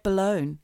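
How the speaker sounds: BALLOON said as "ba-lone"; slow BALLOON with a long O; BALLOON rhyming with bone; mock mispronunciation. Shown as a demonstration. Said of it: "why isn't it balone".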